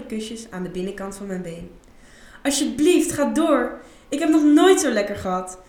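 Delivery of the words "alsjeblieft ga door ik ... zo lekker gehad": higher, louder breathy-free begging plea in her sexiest voice; pleading sexy voice. Acted. Isa begs her client to continue in her sexiest voice. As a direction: beg him to go on, laying it on thick. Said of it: "smeekte ik met mijn meest sexy stem".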